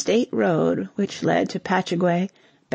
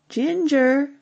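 higher-pitched call of a name; 7 semitones higher than usual. A narrator's reading. Sally calls to Ginger at the gate, calling out. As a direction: call out to someone approaching. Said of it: "she called".

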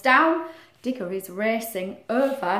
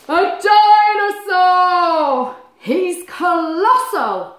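slow, loud, higher drawn-out wonder; amazed gasp; 7 semitones higher, 8 dB louder, much slower than usual. Acted. Animals discovering a giant dinosaur, slow and loud. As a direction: gasp at something enormous.

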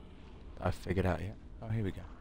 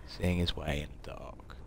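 whispering; 5 semitones lower, 6 dB quieter than usual. Real, not acted. Hiding from zombie with torch.

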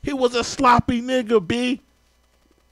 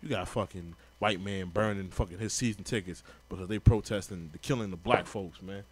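much lower, mumbled mock Jerry Rice; sneering imitation of the football legend, pitched way down; 12 semitones lower, 10 dB quieter than usual. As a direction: put on a low dopey voice to mock him. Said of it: "He was like".

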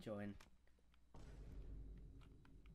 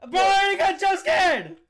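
startled and shaken; real fright after a jump scare; scared; 5 semitones higher, 5 dB louder than usual. Real, not acted. Jump scared in horror game.

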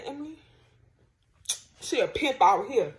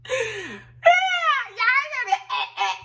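high-pitched giggly hype; laughing; 14 semitones higher, 3 dB louder than usual. Real, not acted. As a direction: squeal it out laughing. Laughing, excited about reaction.

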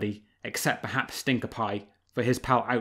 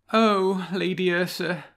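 sighing; 8 semitones higher, slower than usual. A narrator's reading. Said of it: "sighed Renard".

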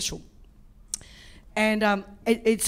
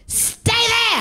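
high barked command; bossy masseuse order; 13 semitones higher, 3 dB louder than usual. Retold or imitated. Masseuse ordering the client to stay, high barked command. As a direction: snap it like a stern masseuse. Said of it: "she's just gone".

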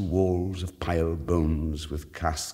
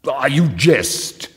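slow, much louder exclamation; loud exclaim; 8 semitones higher, 11 dB louder, much slower than usual. A narrator's reading. Fortunato recoiling from the trowel, loud. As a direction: exclaim in nervous mirth. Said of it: "he exclaimed".

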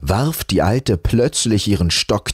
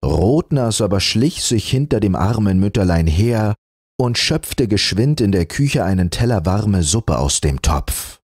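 ordinary delivery, nothing marked

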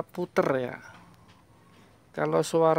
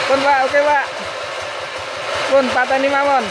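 raised, higher-pitched shout; calling over engine noise; 9 semitones higher, 7 dB louder, faster than usual. Real, not acted. Mechanic telling the owner to switch off, shouted over the engine.

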